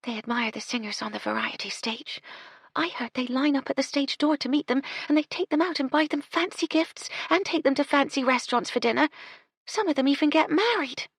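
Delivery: put-on high, girlish, eager; dreamy young maid. Young housemaid tells Gertie about stage-door suitors, high and dreamy. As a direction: rattle on about the toffs.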